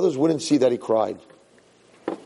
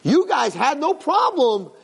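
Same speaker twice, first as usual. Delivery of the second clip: impassioned Yosef; 12 semitones higher than usual. Retold or imitated. Yosef confronting brothers who sold him, rising passion. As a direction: pitch climbing much higher, urgent.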